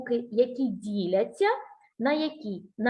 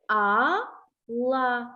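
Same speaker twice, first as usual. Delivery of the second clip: Алла split into two long, separate syllables; very slow, sounded-out Алла. Shown as a demonstration. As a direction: say А, pause, then ла, both held. Teacher shows where А is.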